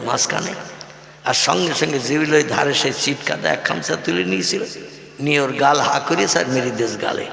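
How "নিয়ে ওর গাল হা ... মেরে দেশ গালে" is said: ordinary delivery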